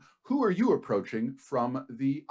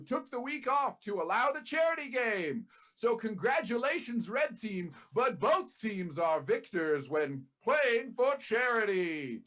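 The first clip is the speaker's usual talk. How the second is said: sports announcer; 12 semitones higher than usual. Acted. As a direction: call it like a stadium announcer. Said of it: "we'll just do the announcer".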